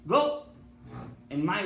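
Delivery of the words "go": sharp high shouted order; urgent command. Retold or imitated. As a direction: fire the word out like a command. Father sends son, shouting command.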